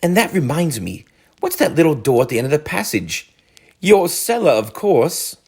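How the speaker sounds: high, lively voicing; character voices. A narrator's reading. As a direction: toss the lines back and forth. Rat and Mole dialogue.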